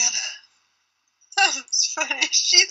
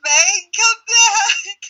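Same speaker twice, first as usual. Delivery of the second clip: drawn-out plea; 4 semitones higher, 5 dB louder, much slower than usual. Real, not acted. Calling friend who left.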